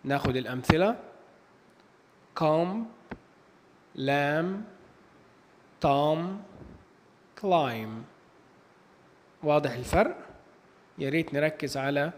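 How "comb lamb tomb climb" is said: "comb, lamb, tom, climb" ending on M, no B sound; silent final B. Shown as a demonstration. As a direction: say "comb, lamb, tom, climb" one at a time, closing on M with the B dropped. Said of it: "حرف ال B لا ينطق". (نطق "comb, lamb, tom, climb" من غير صوت B في الآخر)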